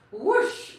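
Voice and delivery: loud, high whooshing noise; whoosh sound effect. Acted. Reading a fart joke to kids, loud whoosh. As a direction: blow it out like a gust of wind.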